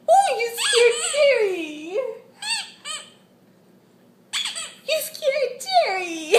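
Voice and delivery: high, laughing exclaim. Real, not acted. Mom laughing as the baby scares the toy mouse.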